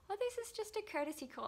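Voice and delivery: very high, quick placating voice; mock caller excuse. Insurance caller excusing the call, high mock-polite voice.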